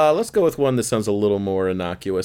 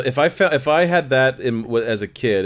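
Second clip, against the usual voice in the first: ordinary delivery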